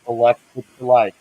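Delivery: muffled through a mask; talking inside a hot mask; inside a rubber mask. Real, not acted. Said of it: "It's frickin' hot in this mask".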